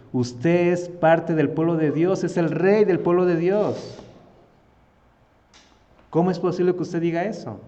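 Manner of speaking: reproche indignado. Acted. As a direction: rebuke the king with mounting force. Acted David rebuking the king. (protesta indignada y enfática)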